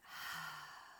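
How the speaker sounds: long soft breathy sigh; gentle releasing exhale; exhale through mouth. Shown as a demonstration. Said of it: "Let it out".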